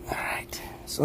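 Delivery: soft unvoiced whisper. Real, not acted. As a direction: hush it to the viewers. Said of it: "whispering to camera".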